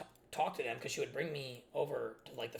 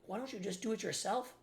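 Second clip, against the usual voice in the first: exasperated retort; 6 semitones higher, 5 dB louder than usual. Retold or imitated. Retorting to hovering mother.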